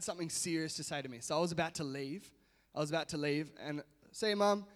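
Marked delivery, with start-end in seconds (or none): 4.1-4.7 louder, higher cheerful farewell; calling out goodbye. Retold or imitated. Son leaving his mum's house, calling goodbye. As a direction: sing out a goodbye on the way out.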